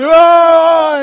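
sudden very loud high shouted oh; a burst of alarm, shouted; startled yell. Retold or imitated. Preacher voices fear letting out a sudden shouted oh. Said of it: "and he's yelling".

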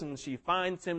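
ordinary talk

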